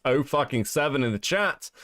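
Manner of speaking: usual speaking voice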